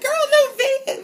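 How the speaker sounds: very high, loud fangirl squeal; excited shriek. Retold or imitated. Fan seeing Gigi's new video, squealing. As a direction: shriek it like you just saw the upload. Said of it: "I get all excited".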